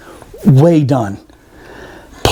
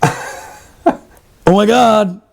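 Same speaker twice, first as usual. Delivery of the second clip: retold dismay; 5 semitones higher, much slower than usual. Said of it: "And I'm like".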